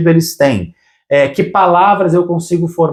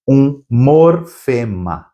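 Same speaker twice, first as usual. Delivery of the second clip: "um morfema" slowly, in separated pieces; slow, split word; much slower than usual. Shown as a demonstration. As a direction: stretch out "um... mor... fema". Teacher naming the key term.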